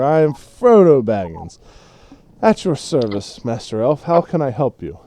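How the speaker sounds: lightly tipsy formal introduction; sloshed hobbit. Acted. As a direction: introduce yourself with mildly drunken grandeur. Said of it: "almost falls over".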